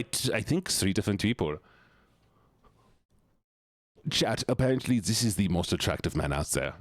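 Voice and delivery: put-on German accent. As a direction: play it with a German accent throughout. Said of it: "I have to drop the accent".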